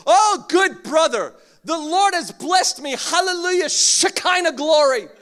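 loud, high, over-cheerful church greeting. Pretend. Preacher mocking a falsely cheerful churchgoer, hammed-up exuberance. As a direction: ham up the always-blessed believer.

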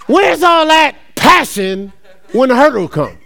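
preaching shout. Acted. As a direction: call them out, slow and loud. Preacher challenging the audience.

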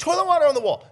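high, loud incredulous exclamation; outraged disbelief. Retold or imitated. Dad finding toilet water on the wall, outraged disbelief. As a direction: exclaim it in comic parental outrage. Retelling his outraged discovery.

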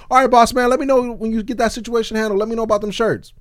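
high, loud eager voice; high, loud mock of an eager moocher. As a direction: play the pushy moocher. Mocking the moocher's reply.

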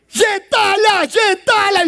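slow, high haughty shout; snooty neighbour shouting insults; Dona Florinda's scorn. Retold or imitated. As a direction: sneer it down at them.

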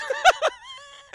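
very high, loud mock sobs; hammed-up crying fit in falsetto; imitated man wailing. Co-host crying after the host's apology, exaggerated sobbing. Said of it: "Is that a grown man crying".

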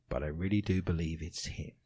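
low, soft confiding voice; hushed sincerity. Acted. Mum believes it is her dad, hushed. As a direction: drop right down, as if confiding. Acting the mother's quoted belief.

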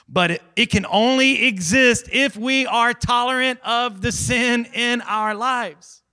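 high, forceful preacher delivery; impassioned preaching. Real, not acted.

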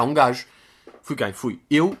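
ordinary talk

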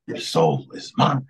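low, slow villain boom; Shao Kahn voice. Retold or imitated. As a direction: growl it out like a warlord claiming a prize. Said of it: "that scene from Mortal Kombat".

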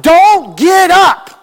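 high, drawn-out shout from a distance; yelled warning. Retold or imitated. Passing driver to a woman who has fallen, yelled warning. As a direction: yell it out slowly and urgently. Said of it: "the lady yelled out".

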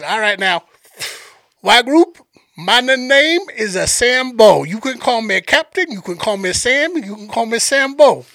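Cajun guide introducing himself to the group; Sambo's Cajun accent. Retold or imitated. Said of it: "I'm gonna play this dude's accent".

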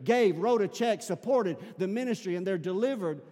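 loud, high, driving sermon delivery; full-force preaching. Real, not acted. Preacher rallying church about lives delivered, impassioned. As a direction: preach it out to the whole room.

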